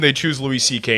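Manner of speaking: usual speaking voice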